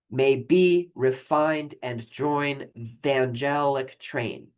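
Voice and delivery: "may be refined and join the angelic train" read very slow, beats leaned on; stressing the meter. Shown as a demonstration. Teacher scanning a line for students, slow and stressed. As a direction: scan the line aloud, slowly, marking each stress. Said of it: "kinda go through yourself and, and hear that".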